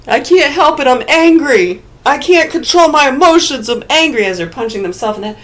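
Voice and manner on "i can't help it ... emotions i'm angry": high, loud whiny fury, hammed up; mock angry outburst. Hammed-up angry person punching their own head, yelling. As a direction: play a grown-up tantrum, yelling that you can't help it.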